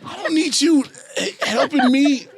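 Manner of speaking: half-joking outrage at being coddled; indignant. Retold or imitated. Actor mocking his own reaction to Aniston, loud and high.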